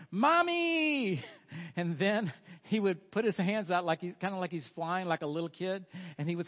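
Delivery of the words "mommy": loud, high, drawn-out playful yell. Retold or imitated. Grown son hailing his mother in public, playful yelling. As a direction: belt it out long like an excited kid. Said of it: "he would start yelling".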